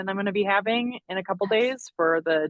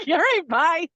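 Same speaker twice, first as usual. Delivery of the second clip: breezy dismissal; 9 semitones higher, 5 dB louder, slower than usual. Retold or imitated. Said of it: "I'm like".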